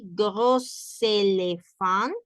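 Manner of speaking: gros éléphant with the S linked as Z, slowly; liaison gros éléphant. Shown as a demonstration. Teacher modelling gros éléphant for Spanish-speaking students. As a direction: link gros into éléphant with a Z sound. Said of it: "La primera se pronuncia así".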